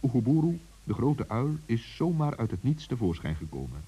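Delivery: ordinary delivery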